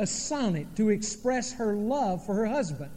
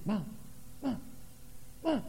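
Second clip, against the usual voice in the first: put-on short, repeated little-kid calls for Mom; child seeking attention; 9 dB quieter than usual. Little boy trying to get his mom's attention, imitated pestering. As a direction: lightly mimic a child pestering a parent.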